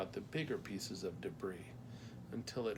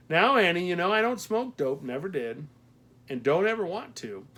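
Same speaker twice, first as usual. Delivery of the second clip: loud, higher mock-stern protest; firm protest; 8 semitones higher, 10 dB louder than usual. A narrator's reading. Mac denies the postmaster's dope joke, loud protest. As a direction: push back firmly at the tease.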